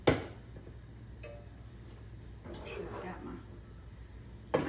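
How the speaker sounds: half-voiced, trailing mutter under her breath; muttered to herself. Real, not acted. Cook checking her skillet mutters to herself, barely voiced. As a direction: mumble it to yourself while checking the pan.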